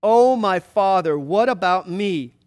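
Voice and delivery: loud, anguished appeal to a father; loud bitter cry. A narrator's reading. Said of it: "a loud and bitter cry".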